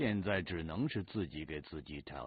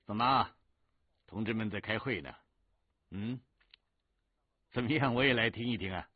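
lightly voiced officer, higher and a bit louder; visiting political instructor; 6 semitones higher, 5 dB louder than usual. Political instructor asks to sit in on meeting, lightly raised voice. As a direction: drop in on the men with a raised greeting. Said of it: "他走过来对大家说".

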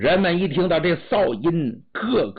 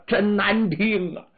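plain reading voice

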